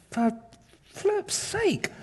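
high squeaky exasperated exclamation; God losing patience, in a squeak. Retold or imitated. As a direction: throw it up high and soft in exasperation. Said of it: "He's like".

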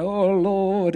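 lightly mock drawn-out praying; a hint of churchy pleading; imitated group prayer. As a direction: intone it like an earnest prayer huddle. Imitating huddle of praying Christians.

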